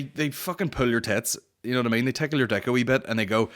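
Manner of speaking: ordinary delivery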